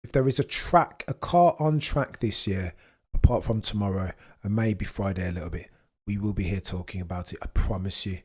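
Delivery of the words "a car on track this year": ordinary delivery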